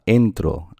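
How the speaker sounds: "entro" with a strong "en" and falling "tro"; stress on first syllable. Shown as a demonstration. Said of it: "aquí está la fuerza".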